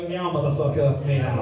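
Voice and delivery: low, defiant cat voice; talking cat. Acted. Cat taunting the dad from the garden, low cat voice. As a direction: meow the words like an insolent cat. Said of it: "If they could talk".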